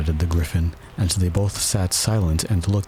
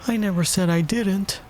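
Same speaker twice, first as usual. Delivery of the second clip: put-on high girlish protest; understated childish crossness; mildly angry; 12 semitones higher than usual. A narrator's reading. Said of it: "interrupted Alice angrily".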